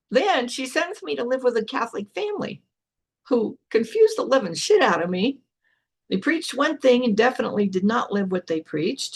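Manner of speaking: normal talking voice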